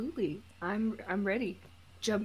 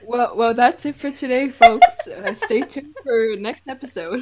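announcer voice; 10 dB louder than usual. Pretend. Mock show sign-off.

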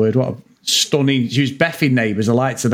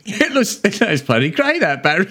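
Cockney Australian redo, laughing; 2 semitones higher than usual. Retold or imitated. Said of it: "Do that again".

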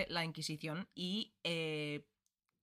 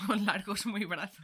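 laughing; 4 dB louder than usual. Real, not acted. Mocking partner's slip of tongue.

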